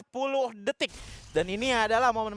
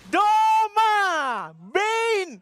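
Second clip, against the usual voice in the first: excited scream; 11 semitones higher, 6 dB louder, much slower than usual. Real, not acted. Caster screams the hero's name.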